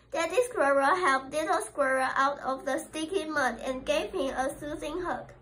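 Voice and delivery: usual speaking voice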